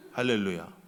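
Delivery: ordinary talk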